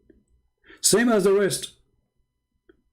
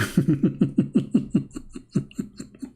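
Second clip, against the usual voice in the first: long, breathy chuckling fit; real laughter; 3 dB quieter than usual. Real, not acted. Speaker laughs at his not walking joke, real laughter. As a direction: dissolve into laughter after the punchline.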